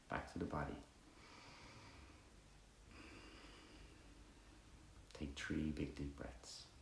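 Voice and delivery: soft, unhurried meditation guidance; guided meditation. Acted. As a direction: guide them gently through body and breath, pausing between phrases.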